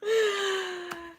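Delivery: high-pitched drawn-out laughing squeal. Real, not acted. Amused by Trini's story, high laughter. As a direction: crack up in a high wheeze. Cracking up about Trini's cussing.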